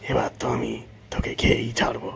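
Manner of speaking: soft, husky whispered menace; breathy villain's aside; scheming tiger. Acted. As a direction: gloat to yourself as a hungry beast. Tiger secretly plots to eat him.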